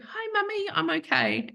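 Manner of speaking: high, sweet little puppy voice speaking to mummy; puppy's voice. Pretend. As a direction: voice the puppy's eyes talking, tender and high. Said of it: "the split second of our eyes meeting".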